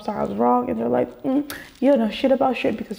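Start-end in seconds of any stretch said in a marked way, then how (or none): none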